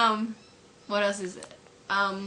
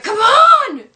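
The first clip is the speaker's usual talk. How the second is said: very high, loud exasperated shout; fed-up, drawn-out yell; angry yell; 15 dB louder than usual. Acted. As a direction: scream it at the traffic. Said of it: "he's so pissed off".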